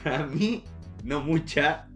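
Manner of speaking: usual speaking voice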